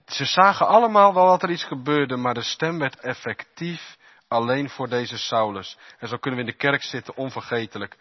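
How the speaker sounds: usual speaking voice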